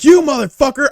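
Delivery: high, loud retold outburst; angry insult. Pharmacist cursing his coworker in an argument, loud high-pitched anger.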